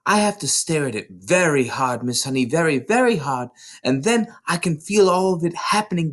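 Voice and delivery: put-on high, bright child voice tumbling with excitement; eager little girl. A narrator's reading. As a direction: play Matilda bursting to tell her teacher. Child Matilda voiced at length.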